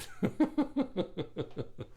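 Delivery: real laugh trailing off. Real, not acted. Amused after singing to viewers, a real laugh. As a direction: chuckle at your own singing.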